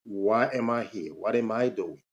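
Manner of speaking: normal talking voice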